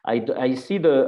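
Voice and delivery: normal talking voice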